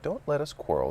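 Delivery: a mild anxious appeal. A narrator's reading. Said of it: "said in an anxious tone".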